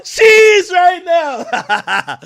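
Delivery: high, drawn-out, loud echo through laughter; laughing mock shout. Reacting to the clip he just heard, laughing mock shout. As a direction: crack up while yelling it back.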